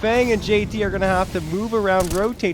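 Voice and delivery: loud, high, stretched-out call; full-force esports commentary; excited play-by-play. Real, not acted. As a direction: shout the big moment to the crowd. Live play-by-play.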